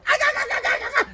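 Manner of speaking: very high repeated cries of pain; mock howling on the ground; writhing yelps. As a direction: yelp over and over while rolling on the ground. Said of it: "he's on the ground going".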